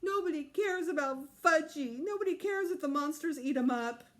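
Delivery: put-on tearful little boy voice; crying child. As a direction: sob it out as a self-pitying toddler. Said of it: "Fudge started to cry".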